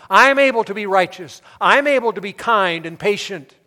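loud, high, rhythmic proclamation; impassioned preaching. Real, not acted. Preacher thanking God for His strength, impassioned preaching. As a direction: build a forceful litany of thanks.

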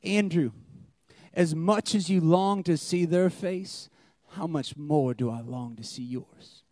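hushed loving question; gentle God voice. Retold or imitated. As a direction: answer kindly, gently correcting. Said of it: "God's gentle reply".

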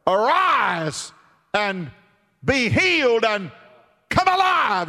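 building from firm to high loud call; Jesus calling the dead boy back to life; rising command. Acted. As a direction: raise the dead boy with a swelling cry. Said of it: "Jesus says to the little boy".

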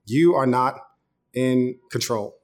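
usual speaking voice

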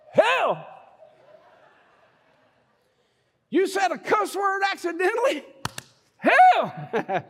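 mock condemnation. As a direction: pass sentence like a horrified strict believer. Mimics verdict of damnation.

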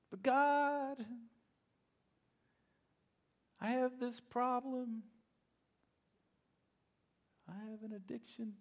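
high, whiny made-up excuse to God. Acted. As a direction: plead a silly excuse, half joking. Congregant's excuse acted out.

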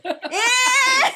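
high, loud shocked squeal into laughter; reacting to a creepy remark, high squeal. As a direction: squeal in horror then laugh. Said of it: "And I was like".

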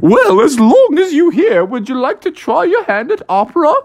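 high, swooping operatic invitation; hammed-up fluty opera voice; haughty opera singer. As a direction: offer it grandly like an opera diva. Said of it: "And they said".

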